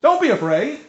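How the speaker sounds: high, gentle voice of Jesus calming his friends; reassuring Jesus. Acted. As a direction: lift the pitch well up and comfort them. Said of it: "Jesus spoke to them and said".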